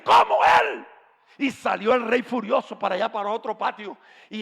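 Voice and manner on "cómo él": very high, loud disbelief; shrill shouted disbelief; shocked cry. Acted. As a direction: gasp it out in horror.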